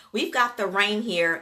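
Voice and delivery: ordinary delivery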